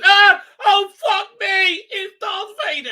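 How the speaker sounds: high, loud panicked screech, slowing on the curse; panicked yelp. Retold or imitated. A fleeing character spots Vader, screaming in terror. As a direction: shriek like you've just spotted the monster. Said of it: "he meets and goes".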